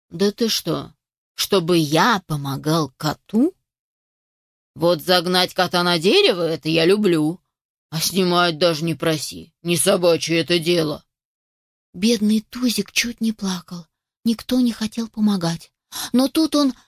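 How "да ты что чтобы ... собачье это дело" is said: low, gruffly dismissive dog voice; scornful old dog. Acted. Yard dog refuses to help a cat, scornful.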